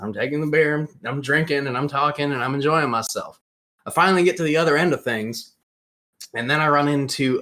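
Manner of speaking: ordinary delivery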